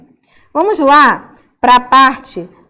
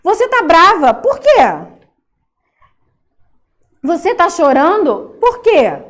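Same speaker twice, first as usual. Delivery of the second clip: concerned questioning; 5 semitones higher than usual. Acted. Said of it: "Então, assim".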